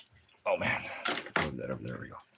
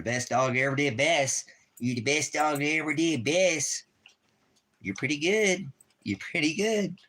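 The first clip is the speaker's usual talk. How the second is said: higher sing-song praise; baby talk praise; 7 semitones higher than usual. Acted. Praising dog in high voice.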